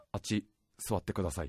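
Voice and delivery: low, soft, gruff understated request; a quiet tough-guy murmur. Retold or imitated. Tough young man offers grandma his seat in a gruff low voice. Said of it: "渋めの声で言って".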